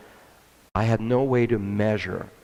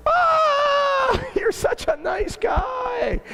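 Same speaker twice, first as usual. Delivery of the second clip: mock pitying colleague; much slower than usual. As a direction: pity the naive boss in a high shout. Colleague pities the naive boss.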